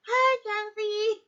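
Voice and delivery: high, loud, slow squawky bird greeting; parrot voice. Retold or imitated. Parrot greeting owner at the door, high slow squawk. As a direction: squawk it like a parrot mimicking speech. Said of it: "she's like".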